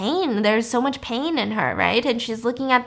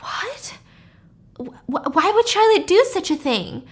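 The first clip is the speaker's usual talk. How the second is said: stunned disbelief; 8 semitones higher than usual. Retold or imitated. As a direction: act out stammering shock, pitch climbing. Couple stunned by the news.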